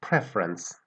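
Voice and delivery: Preference, stress on PRE; weight on PRE in Preference. Shown as a demonstration. Teacher repeating Preference, stress on PRE. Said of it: "on the first syllable".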